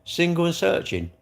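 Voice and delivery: lightly voiced snide onlooker; gossiping neighbour. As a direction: tease him like a nosy neighbour. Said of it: "Even if I just walk out the door".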